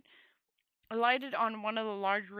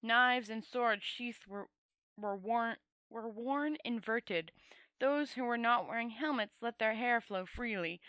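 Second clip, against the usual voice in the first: ordinary delivery, nothing marked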